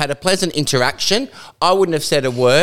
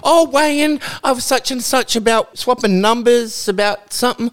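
eager greeting; 10 semitones higher than usual. Retold or imitated. Townsfolk greet him loudly.

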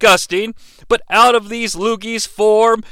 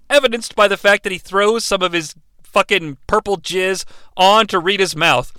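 normal talking voice

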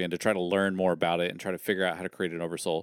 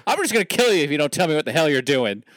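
fast, loud, high mock outburst; comically exasperated rant; exasperated confused threat; 7 semitones higher, 7 dB louder, faster than usual. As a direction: blurt it out as a baffled, fed-up dad. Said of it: "he's so confused that he's just like".

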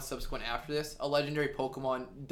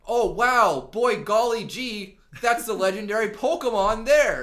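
high, loud gee-whiz voice; cheesy golly-gee enthusiasm, slow on golly; wholesome 1950s kid; 7 semitones higher, 9 dB louder, slower than usual. Retold or imitated. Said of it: "they're like".

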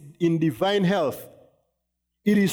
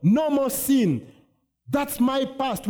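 higher, proclaiming freedom; newly saved joy; 6 semitones higher, slower than usual. Retold or imitated. Preacher voicing new believers rejoicing, higher and joyful. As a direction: shout out your freedom, pitched higher. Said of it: "we are so excited".

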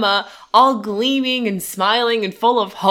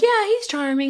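high gushing yeah, he's charming; mock gushing; 12 semitones higher than usual. Fans recalling their early crush on [name]: mock gushing. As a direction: swoon over him mockingly. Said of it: "we were like".

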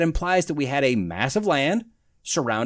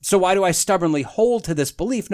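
ordinary delivery

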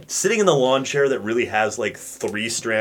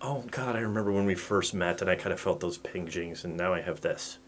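low, soft, wistful husband voice; quiet weary sigh; 6 semitones lower, 13 dB quieter than usual. Pretend. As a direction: murmur it like a tired husband looking back.

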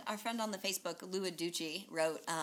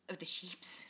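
whispered aside; 3 semitones lower, 4 dB quieter, slower than usual. Real, not acted. Whispered self-correction aside.

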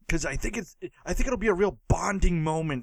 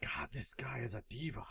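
whispering; 4 semitones lower, 17 dB quieter than usual. Real, not acted. Aside mocking the co-host.

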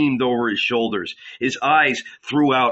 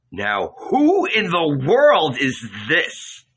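slow, higher mocking question; mocking townsfolk; 4 semitones higher, slower than usual. A narrator's reading. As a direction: sneer it out slowly like a scoffing crowd. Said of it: "they laughed".

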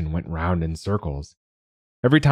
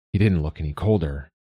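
plain reading voice

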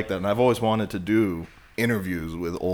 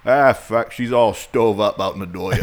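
put-on thick Down East Maine accent, louder, like an old Mainer; 2 semitones higher, 7 dB louder than usual. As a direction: play it up like a rough Maine local, heavy Maine drawl. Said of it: "How would you say that in Maine?".

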